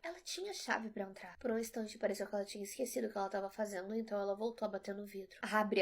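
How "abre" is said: louder, insisting; insistent command through the glass. Acted. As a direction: demand it, no room for refusal. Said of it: "ela insistiu".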